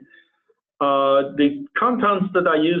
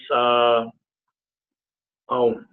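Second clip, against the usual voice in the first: brain freeze; 2 semitones lower, much slower than usual. Real, not acted. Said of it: "I'm having a brain freeze right now".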